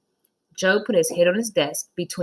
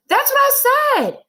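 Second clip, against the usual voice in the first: put-on high, loud protesting kid; child Joe; 6 dB louder than usual. Boy insists to his teacher he counted right, high and indignant.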